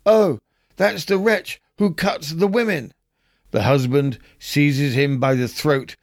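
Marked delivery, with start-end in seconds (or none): 0.0-2.9 a much higher, startled cry at the same loudness; wife pitching up in shock at the monster; wife bursting out. A narrator's reading. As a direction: read it as a light mock gasp of recognition. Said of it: "the wife burst out".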